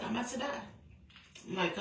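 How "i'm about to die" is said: faint grandma. Retold or imitated. Dying grandma says goodbye.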